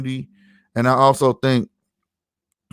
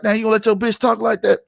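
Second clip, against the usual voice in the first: high-pitched Saint Louis banter; Saint Louis talk; 9 semitones higher, faster than usual. Acted. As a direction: act out Saint Louis friends ribbing each other.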